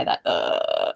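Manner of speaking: very low, creaky fry; glottal fry. Shown as a demonstration. Coach showing the fry some speakers force, low creak. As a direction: drop into a low vocal fry. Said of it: "they get what's called that glottal fry".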